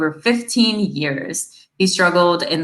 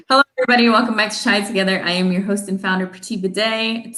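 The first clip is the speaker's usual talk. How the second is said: usual speaking voice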